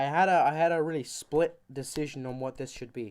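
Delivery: ordinary delivery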